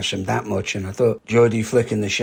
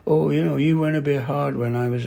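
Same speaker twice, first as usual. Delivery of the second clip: higher-pitched whinge, imitating a grumbler; lightly acted complaint in a raised pitch; mock complaining; 5 semitones higher than usual. Competitor at the pool reception grumbling about a hard bout, mock complaining. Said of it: "this guy comes in complaining".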